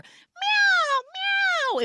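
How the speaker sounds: very high, loud drawn-out mews; squealing chorus-of-cats imitation; cat meowing. Cats watch her climb to the sky, high loud meows. All the cats meow, retold.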